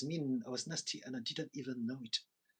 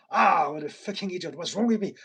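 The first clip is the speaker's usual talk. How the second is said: loud furious outburst at himself; angry self-scolding; 5 semitones higher, 14 dB louder than usual. Retold or imitated. Cursing himself loudly over coffee spilled on his shirt, angry outburst. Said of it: "very aggressive and very angry at myself".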